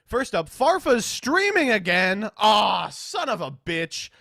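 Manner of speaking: loud, high, drawn-out complaint; comically aggrieved, then easing off; exasperated outburst. Real, not acted. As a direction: cry out in exasperation at bad news. Streamer reacts to rival streaming.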